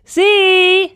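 a loud high call across the water; loud, high hail "See!"; shout. Acted. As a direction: call out as loud as you can to a distant boat. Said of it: "Wieder schrie ich".

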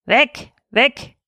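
lightly voiced dog yap; a hint of a barking dog; yapping old dog. A narrator's reading. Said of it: "kläffte der Kettenhund".

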